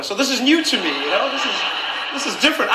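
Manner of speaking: ordinary delivery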